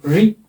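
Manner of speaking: री with a short, unaccented vowel. Shown as a demonstration. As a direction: keep the ee in री brief. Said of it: "छोटा री है ना".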